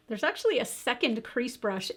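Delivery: ordinary delivery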